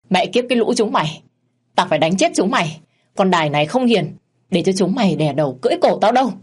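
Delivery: wife beating her husband and his lover, raging; furious threat. Acted. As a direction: spit it out in a fury. Wife rages while beating them.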